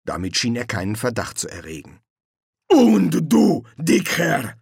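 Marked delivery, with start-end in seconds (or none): 2.7-4.6 loud, high, menacing guard; guard leader. Acted. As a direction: demand an answer from the fat one. Guard leader questions Colon.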